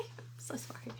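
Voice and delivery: whispered, laughing apology; sheepish whispered laugh; embarrassed laugh. Real, not acted. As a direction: giggle it out under your breath. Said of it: "I just said something gross".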